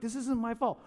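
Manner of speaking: squeaked-up mock indignation; mock yelling. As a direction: imitate a heated protest without the volume. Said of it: "he's, like, yelling at me".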